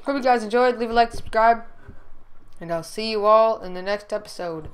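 upbeat sign-off; vlog outro. Real, not acted. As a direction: close the video cheerfully. Video outro.

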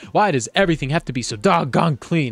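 angry yell. Acted. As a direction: rail at the driver through the glass. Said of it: "Moose blood yells at the driver".

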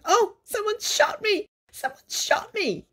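high, slow wailing cry; squeaky creature crying out; shouting brownie. A narrator's reading. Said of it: "he rushed away shouting".

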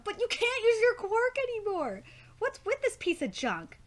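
high, dismayed exclamation; alarmed outburst. Acted. Reading a manga character's reaction to a lost quirk, alarmed. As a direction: cry it out in high-pitched dismay.

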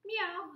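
high meowing; cat meow. Acted. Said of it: "said Cat".

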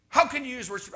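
put-on protest; mock outrage. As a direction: imitate a scandalized critic, high and loud. Mimics outraged Facebook critics.

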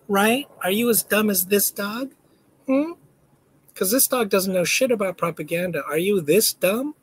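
put-on high, taunting baby talk; mock the viewers like a pet; sneering singsong taunt at gullible viewers. Mocking viewers in dog voice.

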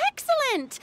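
high, delighted director's exclamation; pleased exclamation. Acted. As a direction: exclaim it high, eager to start the shoot. Director pleased, starts the shoot.

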